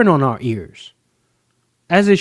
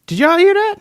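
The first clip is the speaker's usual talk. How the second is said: incredulous; 13 semitones higher, 4 dB louder than usual. Real, not acted. Reacting to a shocking clip.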